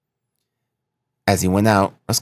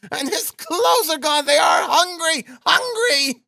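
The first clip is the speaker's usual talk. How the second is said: high anguished shrieking; hysterical, drawn-out high cries; despairing scream; slower than usual. A narrator's reading. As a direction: wail it at the top of your range in despair. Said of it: "screamed in despair".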